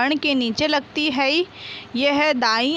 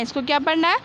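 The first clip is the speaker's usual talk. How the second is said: ordinary delivery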